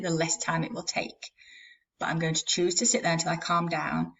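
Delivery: ordinary talk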